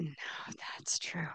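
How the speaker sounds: barely voiced, almost a whisper; hushed admission. Admitting to God in a near whisper. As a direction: let the admission slip out under your breath.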